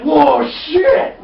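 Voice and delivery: high, loud startled yell; mock alarm. Girl meets monster in the pit, mock alarmed yell. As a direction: burst out in hammed-up shock.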